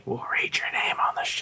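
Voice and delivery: hushed, voiceless stage whisper; whispered aside. Acted. Co-host to listeners after the review plea, whispered. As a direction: whisper it like a secret promise.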